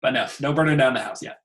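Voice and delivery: normal talking voice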